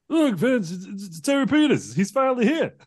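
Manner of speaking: excited commentator. Acted.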